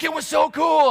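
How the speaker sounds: high, loud gush; gushing excitement. Retold or imitated. Friends in the car gushing, high and loud. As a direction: rave about it. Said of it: "everybody else in the car was like".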